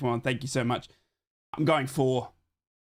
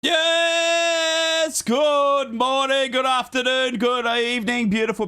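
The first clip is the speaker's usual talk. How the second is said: loud, slow, higher showman greeting; big hype intro; 9 semitones higher, 5 dB louder, much slower than usual. Acted. Host opening his stream with a booming greeting. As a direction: stretch it out like a stage host.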